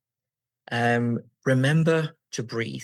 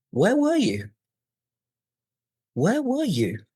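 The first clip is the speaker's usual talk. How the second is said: "Where were you" swooped up very high; exaggerated high lift on "were you", held then released; pitch going up; 10 semitones higher, 4 dB louder than usual. Shown as a demonstration. Teacher demonstrating hand-guided question intonation, pitch soaring up. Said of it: "Up you go".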